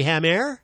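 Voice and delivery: "Hammer" turned into "ham-AIR"; French-style Hammer. Shown as a demonstration. Host guesses the French name, "Hammer" said "ham-AIR". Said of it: "in France, it, his name would be".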